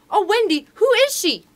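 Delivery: very high, loud gasping question; excited cry. A narrator's reading. Nibs asking Wendy about the mystery lady, excited. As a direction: burst out, dying to know. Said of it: "cried Nibs, every bit as excited".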